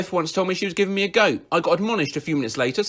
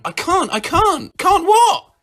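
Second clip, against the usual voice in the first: high whiny voice; 5 dB louder, slower than usual. Retold or imitated. Brother and dad dialogue.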